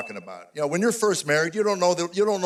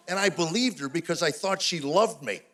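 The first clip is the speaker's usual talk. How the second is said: ordinary talk, nothing marked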